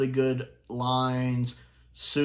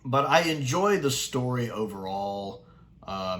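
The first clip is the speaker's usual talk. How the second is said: ordinary talk, nothing marked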